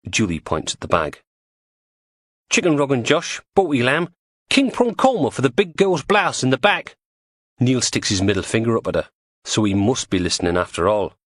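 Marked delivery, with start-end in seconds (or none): 2.5-7.0 higher, brighter estuary woman; lightly voiced cockney woman; Julie's voice. Julie reads out the takeaway order.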